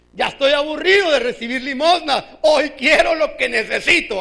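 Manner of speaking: forceful, insistent declaration; fed-up demand. Acted. Preacher voicing Bartimeo demanding what he needs, fed-up demand. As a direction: demand it as a beggar who has had enough. Bartimeo's complaint repeated with resolve.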